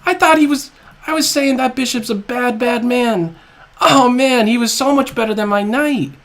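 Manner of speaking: high, loud, breathless glee; excited shouting. Real, not acted. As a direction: keep yelling with joy. Still elated after win.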